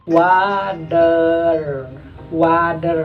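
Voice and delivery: water said American, wadder, T as D and R stretched; American water with a flapped T and a drawn-out R; American pronunciation. Shown as a demonstration. Teacher demonstrating American water, T as D. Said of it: "maka bacanya water".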